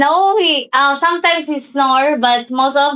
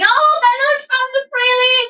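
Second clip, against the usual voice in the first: high, singsong hello; yelled greeting; 10 semitones higher than usual. Shown as a demonstration. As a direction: sing out a high, excited welcome. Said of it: "have to yell that loud".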